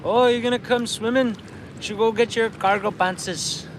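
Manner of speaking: retold friend in a thick native Canadian accent; thick reserve accent. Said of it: "they got thick accents".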